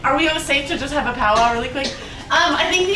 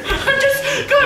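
high-pitched frightened plea; real fright, voice pitched up; scared; 9 semitones higher than usual. Real, not acted. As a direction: call to a friend in rising fear. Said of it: "I'm scared".

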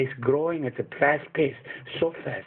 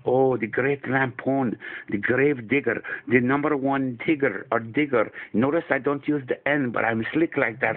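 put-on Afghan accent. Comedy vlog held in put-on accent.

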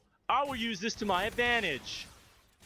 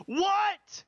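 outraged disbelief; 9 semitones higher than usual. Real, not acted. Player stunned by game result, outraged shout.

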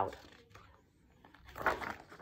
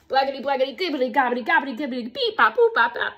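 bouncy tongue-twisting gibberish patter; silly sounds; 4 dB louder, faster than usual. Acted. Grown-up made to make silly sounds for kids, bouncy gibberish. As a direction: rattle off nonsense, popping the beeps. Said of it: "make silly sounds like".

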